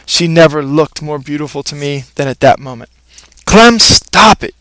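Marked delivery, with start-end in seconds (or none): 3.4-4.6 slow, higher, firm command at the dog; higher, slow stern shout at a misbehaving dog. Acted. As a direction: stop the dog in its tracks. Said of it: "she shouted in a very firm voice".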